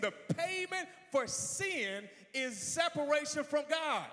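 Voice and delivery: high, loud, ringing proclamation; full-force preaching. Real, not acted. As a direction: drive it home to the congregation at full voice.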